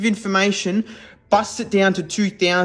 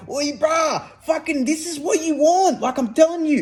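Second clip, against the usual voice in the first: high, brash bloke urging you on; pushy inner voice; 10 semitones higher than usual. Retold or imitated. One part of you arguing with the other, high brash bloke. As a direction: play a cocky mate pushing his case. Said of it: "he's like".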